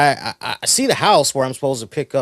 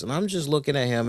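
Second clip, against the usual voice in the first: ordinary delivery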